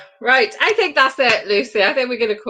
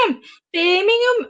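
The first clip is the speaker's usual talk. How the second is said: higher-pitched attempt at a Birmingham accent; 6 semitones higher, faster than usual. Cohost tries out the town's name, mock Birmingham accent. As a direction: have a go at a Brummie accent. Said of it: "Is that a Birmingham accent?".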